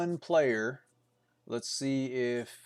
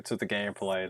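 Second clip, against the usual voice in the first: normal talking voice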